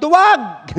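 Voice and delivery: high, loud yell of Duwag; angry shout. Acted. Angry boy yelling an insult into the mountains. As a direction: hurl the insult as loud as you can. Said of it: "Sumigaw siya ngayon ng malakas".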